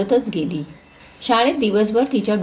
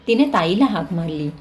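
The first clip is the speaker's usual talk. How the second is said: usual speaking voice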